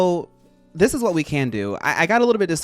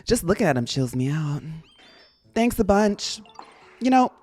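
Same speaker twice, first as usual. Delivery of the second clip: chipper cartoon critter voice, higher; character voice; 4 semitones higher than usual. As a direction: voice a laid-back game creature. Reading Scyther's game dialogue.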